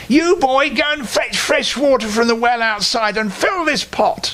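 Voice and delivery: high, sharp crone ordering; bossy witch. A narrator's reading. Witch ordering the boy to fetch water, high and bossy. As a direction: bark the chore at the boy. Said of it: "she said".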